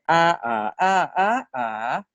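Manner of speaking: "ah" run through five Thai tones; all five tones on ah. Shown as a demonstration. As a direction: step "ah" through each tone. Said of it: "Common tone, tone one, tone two, tone three, tone four".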